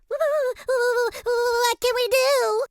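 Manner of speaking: very high, slow, quavering piglet; frightened question. A narrator's reading. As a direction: stammer it out in a squeaky fright. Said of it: "asked Boris".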